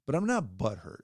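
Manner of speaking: ordinary talk, nothing marked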